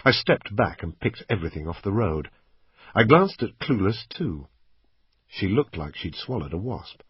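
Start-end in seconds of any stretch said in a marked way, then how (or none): none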